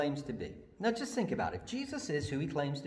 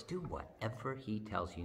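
soft repeat; 4 semitones lower, 9 dB quieter than usual. Acted. Soft take after loud one.

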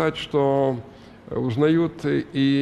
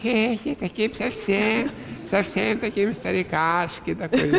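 put-on doddery old man; 8 semitones higher than usual. Mocking how the dubber made him sound, a high old-man voice. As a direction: play a silly little old man, pitched up. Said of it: "делал так".

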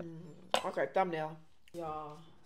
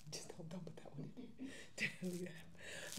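hushed side whisper; 2 semitones lower, 10 dB quieter than usual. Real, not acted. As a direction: whisper it so no one hears. Whispered aside off camera.

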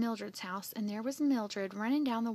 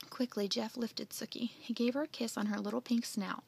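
ordinary delivery

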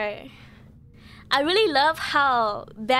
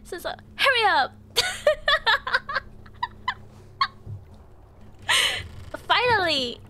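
crying with laughter; 9 semitones higher than usual. Real, not acted. Speaking through laughter-tears.

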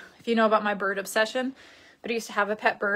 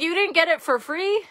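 imitating mad dad; 6 semitones higher, 4 dB louder than usual. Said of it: "My dad was so mad".

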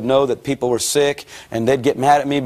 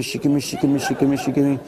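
imitating speaking in tongues; faster than usual. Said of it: "they were doing the shikimi".

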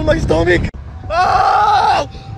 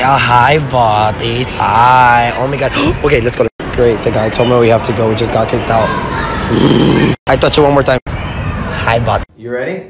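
deep put-on voice; 13 semitones lower than usual. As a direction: rumble every word from deep down.

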